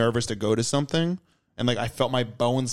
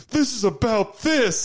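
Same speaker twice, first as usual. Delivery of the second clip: high, slow, wailing cry-voice; mock tearful realization; 11 semitones higher, slower than usual. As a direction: blurt it through fake sobs. Realizing while crying at musical.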